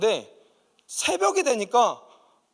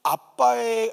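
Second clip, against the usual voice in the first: normal talking voice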